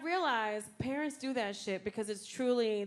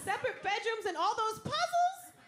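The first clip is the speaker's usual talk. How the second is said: mock cheer; 8 semitones higher, 3 dB louder than usual. Mocking parents' excuses, laughing.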